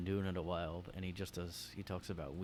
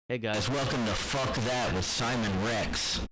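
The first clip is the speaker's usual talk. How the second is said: loud mock radio intro; rant show host; 3 semitones higher, 10 dB louder than usual. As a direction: announce your show at full volume.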